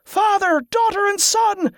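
very high celebratory yell; high-pitched chant-like cheer; triumphant yell. A narrator's reading. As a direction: yell it out from the crowd. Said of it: "yelled another".

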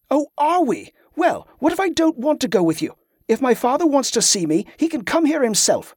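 high, angry, defiant retort; angry outburst. A narrator's reading. Teen snapping angrily at Carla over leaving. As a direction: lash out at the stepmother figure. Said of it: "suddenly angry".

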